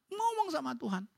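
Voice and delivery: ordinary talk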